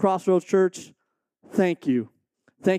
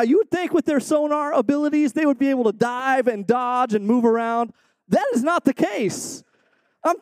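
ordinary delivery

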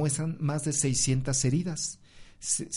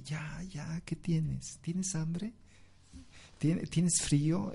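much softer, tender mother's voice; soft soothing mother; 3 semitones higher, 6 dB quieter, slower than usual. Retold or imitated. Mother soothing her crying baby at midnight, soft and tender. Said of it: "con una voz suave le dice".

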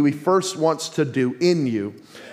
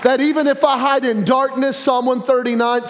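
preaching yell; 7 semitones higher than usual. Real, not acted. Preacher yelling about hiding in darkness. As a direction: let it ring out over the room. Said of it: "sorry, I'm yelling already".

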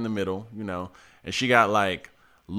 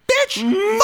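very high-pitched imitated insult; mock fighting girl; 3 dB louder than usual. Girl grabbing her rival's hair, mock angry. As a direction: squeal it as a girl mid-fight.

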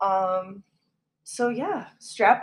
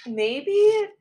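teasing; 12 semitones higher, 5 dB louder than usual. Real, not acted. Teasing afterthought.

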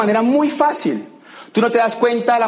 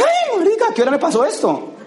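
much higher, groggy shock at waking up; the hungover guy jolting awake, higher; startled partier; 11 semitones higher than usual. Retold or imitated. Waking up after a binge. (recién despierto, sorprendido y agudo)

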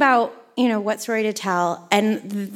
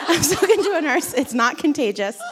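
higher, laughing repeat of a fib; amused retelling; 4 semitones higher than usual. Retold or imitated. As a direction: repeat the reassurance through a laugh. Repeats her admitted lie, laughing.

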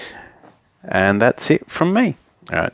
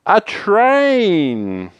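high, long drawn-out swoop downward; dramatic reveal; 10 semitones higher than usual. Acted. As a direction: announce it like a grand attraction, stretched out. Fox's comic big reveal.

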